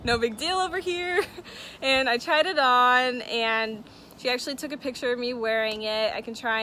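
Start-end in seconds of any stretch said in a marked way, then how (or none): 0.0-1.2 higher, jokingly nonchalant; mock casual. [name] joking about wearing the costly necklace, mock nonchalance. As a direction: play it cool as a joke. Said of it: "I'm like".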